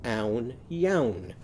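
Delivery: "Own, yown" as two beats with ow vowels; changed vowels. Shown as a demonstration. Teacher modelling owl's onion, "Own, yown" split with ow sounds. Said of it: "it's not gonna be onion. Listen to this".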